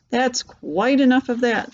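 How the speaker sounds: plain reading voice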